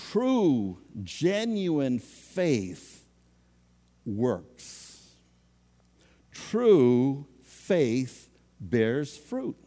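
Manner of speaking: very slow, weighty delivery; deliberate slow pulpit emphasis; slow emphatic statement. Real, not acted. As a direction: land each word. Preacher emphasizing key truth.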